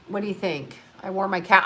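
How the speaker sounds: ordinary talk, nothing marked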